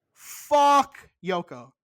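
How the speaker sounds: slow loud curse. Real, not acted.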